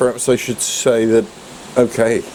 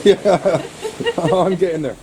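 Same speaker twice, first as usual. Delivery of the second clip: laughing; 8 semitones higher, much slower than usual. Real, not acted. Laughs while arriving.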